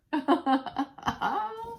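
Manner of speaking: high laugh. Real, not acted. After splash.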